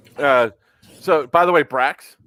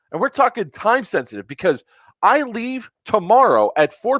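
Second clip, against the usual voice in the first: raised, emphatic customer complaint; firm angry complaint; 4 semitones higher than usual. Real, not acted. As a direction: lay down the law to a service agent. Firm complaint call to company.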